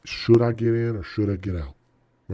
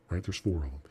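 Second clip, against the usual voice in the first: normal talking voice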